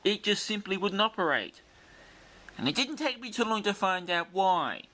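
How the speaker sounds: ordinary delivery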